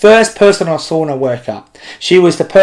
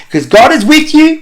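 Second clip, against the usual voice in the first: sharply higher, bright emphatic point; emphatic preaching; 11 semitones higher, 3 dB louder than usual. Real, not acted. Preacher assuring the kids, pitched high and emphatic. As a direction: lift it up as the heart of the message.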